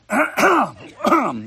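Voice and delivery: hard throat clear. Real, not acted. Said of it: "I just swallowed a bug".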